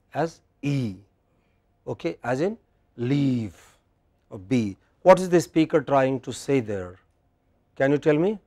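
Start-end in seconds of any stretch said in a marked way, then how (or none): 3.0-3.5 "leave" with a long ee; long vowel. Shown as a demonstration. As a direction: stretch the ee in "leave". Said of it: "the other is long".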